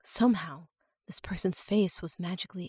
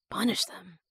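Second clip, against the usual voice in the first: hushed question. A narrator's reading. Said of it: "Link asked".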